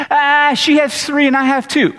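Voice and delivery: put-on slow, high whiny kid voice; whining child. Sulking kid repeats candy complaint to pastor, slow high whine. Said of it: "They go".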